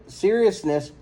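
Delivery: ordinary delivery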